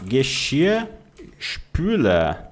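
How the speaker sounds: Geschirrspüler drawn out syllable by syllable; very slow model. Shown as a demonstration. Teacher models long German word very slowly. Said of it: "Olha só que palavra interessante".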